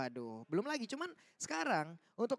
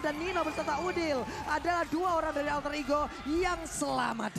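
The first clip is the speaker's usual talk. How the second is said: play-by-play commentary =29; 5 semitones higher, 5 dB louder than usual. Acted. Live esports play-by-play.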